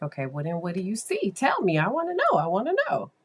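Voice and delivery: high, impatient pleading to be told; retold burst of rising, urgent curiosity; eager questioning. Therapist pressing a child to tell what they see, eagerly.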